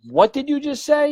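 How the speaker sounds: high-pitched retold disbelief; incredulous. Medium astonished by client's admission, incredulous. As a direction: demand a repeat, pitch jumping way up.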